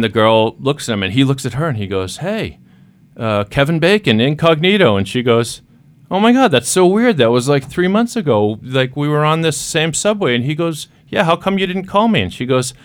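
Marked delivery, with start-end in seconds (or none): 2.2-5.0 playful high greeting; acted guy's greeting. Man reminds the girl of the subway joke, high and playful. 6.1-10.3 put-on high, excited girl's voice; voiced surprised girl. Girl recognizes the guy from the subway, high and excited. 11.1-12.2 high, pleading question; acted hurt question. Man asks the girl why she never called, high and pleading. Guy voiced in retold story.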